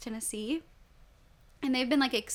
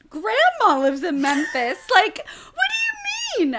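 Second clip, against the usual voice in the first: put-on horrified child; 10 semitones higher, 11 dB louder, slower than usual. Child horrified at earthquake news.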